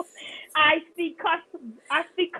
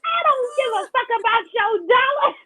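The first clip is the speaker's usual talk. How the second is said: high, squeaky imitation of a little boy; high-pitched little-boy retelling; 9 semitones higher than usual. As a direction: mimic a kid squeakily protesting to mom. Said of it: "This is his little squeaky voice".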